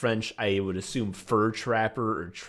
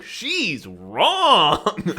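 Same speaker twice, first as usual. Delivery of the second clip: high, louder acted correction; hammed-up parental ruling, pitched up; emphatic mom verdict; 6 semitones higher, 5 dB louder than usual. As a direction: declare it as an indignant mom. Mom contradicts teacher, acted.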